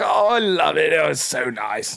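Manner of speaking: gush, then ease off contentedly. Retold or imitated. God finishes enjoying the dancers, gushing.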